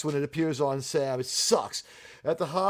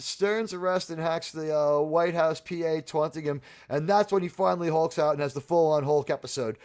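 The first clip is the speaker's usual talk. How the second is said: normal talking voice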